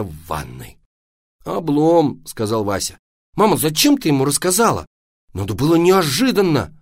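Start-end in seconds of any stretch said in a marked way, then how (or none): 1.4-2.2 put-on louder, higher boy's exclamation; disappointed kid's complaint; let down. Вася разочарован бегством папы. 3.3-6.8 put-on high, loud boy's protest; reproachful. Вася упрекает маму.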